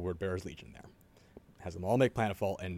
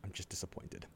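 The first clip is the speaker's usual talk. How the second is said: softer, quietly disappointed with a touch of smug; slightly smug; 8 dB quieter than usual. Retold or imitated. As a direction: let him down gently, a little superior. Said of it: "With a slight bit of smug".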